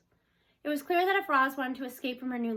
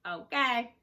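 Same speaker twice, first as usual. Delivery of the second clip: even, timbre-shifted robot reply; 4 dB louder than usual. A narrator's reading. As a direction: answer like a calm machine. The robot answers.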